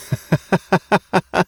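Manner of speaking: sudden high burst of laughter; high mocking laugh; loud laugh. A narrator's reading. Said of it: "lachte jemand laut auf".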